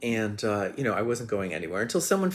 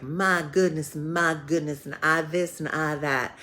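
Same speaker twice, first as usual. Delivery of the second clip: put-on strong Southern accent, higher and louder; Shirley; 7 semitones higher, 5 dB louder than usual. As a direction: imitate a Southern woman exclaiming, pitch lifted. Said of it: "the stronger her accent got".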